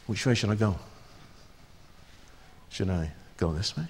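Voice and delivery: quiet, hesitant wondering; lost in the dark. Acted. Preacher acting lost in a dark room, hushed. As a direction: feel your way, unsure and hushed.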